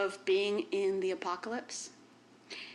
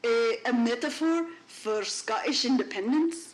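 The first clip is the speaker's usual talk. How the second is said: put-on hammy Scottish accent, higher and louder; bad Scottish accent; 4 semitones higher, 6 dB louder than usual. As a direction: try a Scottish accent, badly. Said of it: "That was the worst Scottish accent".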